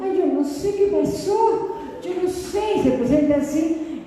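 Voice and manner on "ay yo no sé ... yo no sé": mock whimper. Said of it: "Y ahora está llorando, diciendo".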